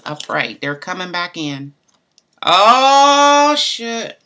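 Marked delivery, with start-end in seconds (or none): 2.4-4.2 a very loud, high "Oh, shit" that bursts out; startled shout. Real, not acted. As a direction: shout it, genuinely shocked. Startled again at card.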